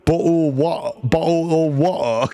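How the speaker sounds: clipped British "bo-, wa-" imitation; imitating chat's British "water"; mock British water. Streamer mocking chat's British accent, playful. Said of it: "W A space E R".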